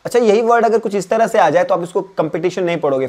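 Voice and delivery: ordinary talk, nothing marked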